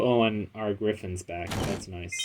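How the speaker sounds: ordinary delivery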